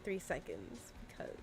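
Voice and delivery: hushed, breathy aside; whispering. Real, not acted. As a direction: drop to a whisper. Aside about her cat.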